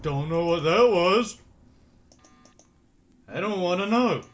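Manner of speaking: loud, rattled outburst; loud startled. Real, not acted.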